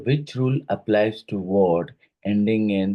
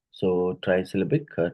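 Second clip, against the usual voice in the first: ordinary talk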